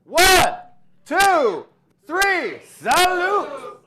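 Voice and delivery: loud, high toast countdown; shouting. Real, not acted. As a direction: call it out to the whole room.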